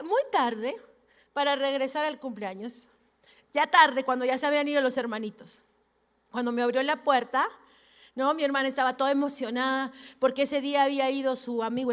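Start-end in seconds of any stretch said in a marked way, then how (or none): none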